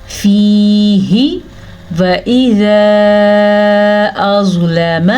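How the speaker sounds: very slow melodic chant; Quran recitation. Shown as a demonstration. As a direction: stretch the vowels in recitation. Recites the verse.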